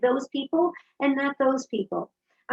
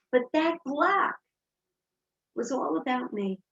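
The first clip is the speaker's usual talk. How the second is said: ordinary delivery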